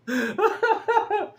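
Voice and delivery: high-pitched burst of giggling; squeaky real laugh at himself; high giggle. Real, not acted.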